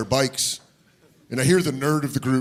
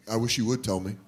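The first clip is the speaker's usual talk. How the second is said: soft, lower earnest comfort; tender dad; 4 semitones lower, 7 dB quieter, faster than usual. Retold or imitated. Dad gently comforting a fatherless kid, soft and low. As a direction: play the caring father gently.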